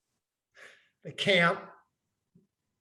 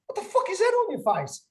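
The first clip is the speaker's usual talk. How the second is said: much higher, louder, fast and laughing; shocked shriek; 7 dB louder than usual. Retold or imitated. Mate seeing blue on his face, shrieking in shock.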